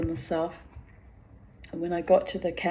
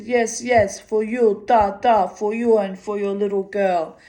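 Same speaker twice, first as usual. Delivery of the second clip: put-on loud, insistent Russian accent; 3 semitones higher, 6 dB louder than usual. The pavilion man insists she take the book, in a loud Russian accent. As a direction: press the gift on her in a Russian accent. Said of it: "He said".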